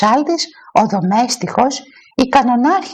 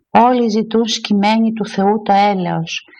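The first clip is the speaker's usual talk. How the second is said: usual speaking voice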